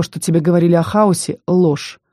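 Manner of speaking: ordinary delivery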